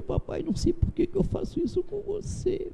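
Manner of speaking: put-on high, soft, tearful little girl; a daughter sorry and crying to her father; tearful child. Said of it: "Ela começou a chorar".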